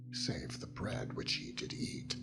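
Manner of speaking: ordinary talk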